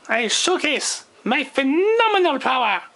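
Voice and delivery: ordinary talk, nothing marked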